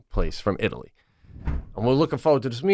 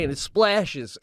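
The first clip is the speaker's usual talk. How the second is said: ordinary talk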